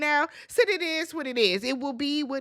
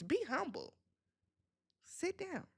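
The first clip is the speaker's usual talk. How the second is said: hushed quote; 4 semitones higher, 16 dB quieter than usual. Retold or imitated.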